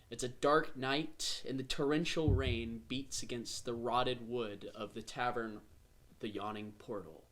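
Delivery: slow, low dark scene setting; story narration. A narrator's reading. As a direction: set a grim rainy scene slowly.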